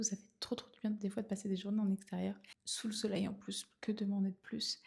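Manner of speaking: soft whisper to the camera. Real, not acted. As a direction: keep it hushed, barely voiced. Chuchote tout le vlog du matin. (chuchotement doux et continu face caméra)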